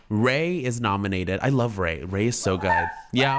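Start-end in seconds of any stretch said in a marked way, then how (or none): none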